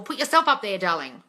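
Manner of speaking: ordinary talk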